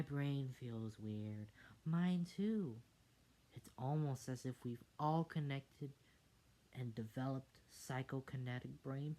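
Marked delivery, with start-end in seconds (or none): none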